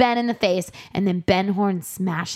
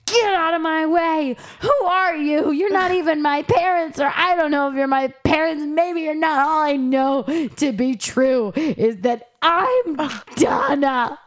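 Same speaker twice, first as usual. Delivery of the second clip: hammed-up furious daughter storming in; angry Donna; 8 semitones higher than usual. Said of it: "She's like".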